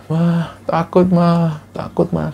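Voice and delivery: put-on a feverish child whimpering for mama; delirious child. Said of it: "mengigau lagi".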